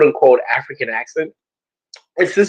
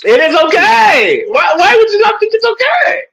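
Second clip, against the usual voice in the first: mock outrage. Friend squeals in playful outrage at her cohost.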